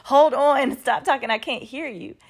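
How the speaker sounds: exasperated calling out. Retold or imitated. Shopper in headphones interrupts two talkative strangers, exasperated and loud. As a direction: cut in loudly over chatter.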